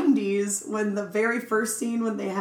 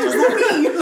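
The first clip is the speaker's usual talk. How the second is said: high, loud confused squeal; squealing question; 11 semitones higher, 7 dB louder than usual. Pretend. Clueless cop, rising squeal.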